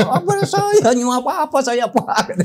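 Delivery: high, loud imitation of tearful protest; mock crying wail. Retelling how the summoned man cried to him, hammed-up wail. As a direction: wail it out comically, pitch jumping way up. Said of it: "nangis dia".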